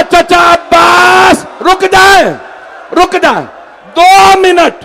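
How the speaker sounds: high, loud sobbing plea, slowly drawn; crying child's plea. A narrator's reading. Orphaned girl crying out to uncle Abbas, high sobbing plea. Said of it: "बच्ची ने रो के कहा".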